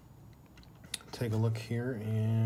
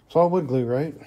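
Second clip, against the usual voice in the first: higher and louder, playful burst; excited; 5 semitones higher, 11 dB louder than usual. Real, not acted. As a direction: pop it out with a grin. Joking about his glue bottles.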